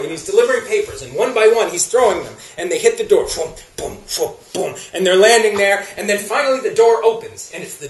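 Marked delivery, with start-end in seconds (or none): 3.3-4.7 soft, low breath-and-thud noises; thudding newspapers. Retold or imitated. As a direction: mimic papers flung against a door. Imitates newspapers hitting door.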